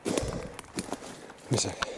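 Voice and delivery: slow breathless whisper; out of breath. Real, not acted. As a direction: gasp it out, winded. Catching breath after climb.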